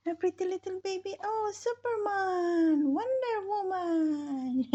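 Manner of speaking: high, loud sing-song cooing. Real, not acted. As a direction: exclaim to delight an infant, stretched out high. Playful cooing at the baby.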